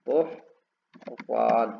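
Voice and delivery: बहरान very slow with a long majhul ओ, like bohran; drawn-out vowel. Shown as a demonstration. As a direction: stretch the ओ in बहरान slowly. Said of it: "ये मजहूल है". (मजहूल ओ खींचकर बोहरान)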